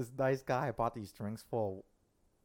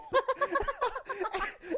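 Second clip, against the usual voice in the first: high, loud burst of laughter; a loud, shrieking laugh; big laugh; 4 dB louder than usual. Real, not acted. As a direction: crack up helplessly. Laughing fit during embarrassing story.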